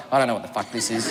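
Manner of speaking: low, quick, soft muttering; hushed retold bewilderment; bewildered aside. Bewildered aside while others swap numbers.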